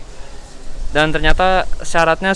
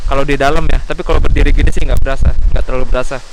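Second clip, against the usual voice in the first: loud raised voice over roaring wind; shouting over wind; 7 dB louder than usual. Real, not acted. Outside on deck in strong wind.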